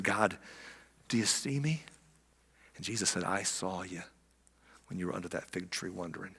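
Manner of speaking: soft, awed near-whisper; hushed awe. Real, not acted. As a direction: tell it like a secret that moved you. Hushed awe for many lines.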